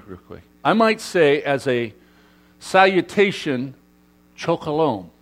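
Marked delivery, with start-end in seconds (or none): 4.4-5.1 "Csokolom" said softly as CHOKE-oh-lome; Hungarian greeting. Shown as a demonstration. Pastor showing the Hungarian greeting, soft Hungarian pronunciation. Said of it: "right, in Hungarian".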